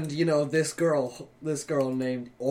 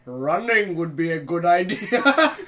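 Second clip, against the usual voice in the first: put-on slow, louder French-accented deadpan line cracking into laughter; mock French accent; 5 dB louder, slower than usual. Co-host as the French guy looking up at Godzilla, French accent. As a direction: do a hammed-up Frenchman, drawn out. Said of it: "cuts to this French guy".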